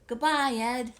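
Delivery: loud, cheery call out the door; friendly shouted farewell; calling goodbye. Acted. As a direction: call after the leaving mailman. Ernie calling out to mailman.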